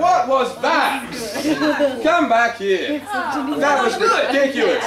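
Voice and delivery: loud, high, drawn-out disbelief; exasperated director. Acted. Director berating overacting cast, exasperated and loud. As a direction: scold them at full voice, stretching the words.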